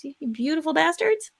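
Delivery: ordinary talk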